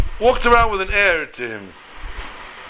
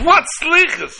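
much higher-pitched puzzled retort; acted bewilderment, pitched way up; baffled outburst; 12 semitones higher, 3 dB louder, slower than usual. Woken rabbi to hosts about Slichas, baffled and high.

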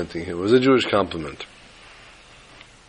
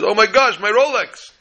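high-pitched horrified exclamation; mock horror; 10 semitones higher than usual. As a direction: cry out as if you just spotted a terrible loss. Lady discovers loss, acted shriek.

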